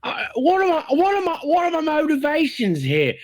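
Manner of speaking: high-pitched, mock-panicked actor; floundering actor. Lost performer begs for his motivation, mock panic. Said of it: "I can't find the character".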